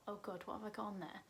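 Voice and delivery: quick, hushed aside to yourself; panicked inner thought. Retold or imitated. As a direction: think it under your breath, fast. Acts out anxious inner thought.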